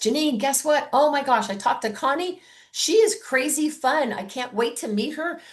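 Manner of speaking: high, gushing excitement to the hostess; excited voice text. Shown as a demonstration. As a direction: sell it like thrilling news. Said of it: "So you've gotta be excited".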